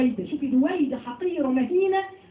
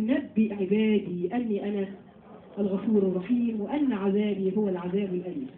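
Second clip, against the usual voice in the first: Quran recitation; 5 semitones lower than usual. Acted. Recites Quran verse in lesson.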